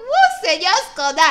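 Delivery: loud, high mock scream; shocked scream. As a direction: shriek in scandalised shock. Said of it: "je dis que j'ai crié".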